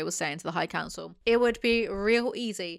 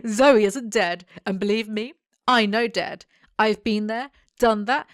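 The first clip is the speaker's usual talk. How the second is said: defiant declaration; 7 dB louder than usual. Acted. [name] voiced, defiant.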